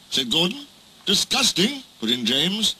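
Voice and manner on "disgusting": high-pitched word of disgust, lifting at the end; outraged. Acted. James joins the other engines' protest, outraged. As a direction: exclaim in offended disapproval.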